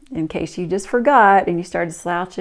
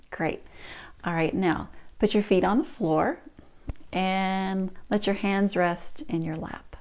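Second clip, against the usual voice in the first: calm grounding exercise. Shown as a demonstration. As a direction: settle them gently into stillness.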